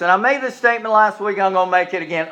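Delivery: normal talking voice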